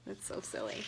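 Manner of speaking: low, soft whispered chuckle of a remark. Real, not acted. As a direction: hush it as an amused aside. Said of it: "amused whispered comment".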